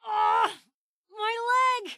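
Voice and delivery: high, shouted cry of pain. Acted. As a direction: yell out as the leg gives way. Crippled leg in combat.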